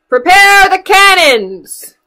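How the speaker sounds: high, loud, slow pirate yell. Acted. Little pirates yell to their mommy in a high, loud voice. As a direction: bellow the order. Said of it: "yell her little pirates".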